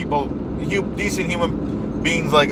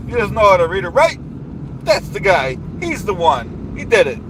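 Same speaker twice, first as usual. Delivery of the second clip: blustering rally-speech voice, big falling endings; pompous politician rant; 2 semitones higher than usual. Acted. Comic ranting at the audience about the dues payer, blustering politician voice. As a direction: rant like a self-important demagogue at a rally.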